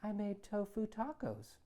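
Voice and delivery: mother sweetly announcing dinner, unaware of embarrassing son; angelic voice. Acted. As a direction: innocent sing-song mom. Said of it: "In an angelic voice".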